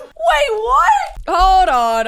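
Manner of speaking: high-pitched, startled disbelief; shocked. Real, not acted. Reactor hears the raunchy joke, shocked. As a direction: cry out as if you misheard.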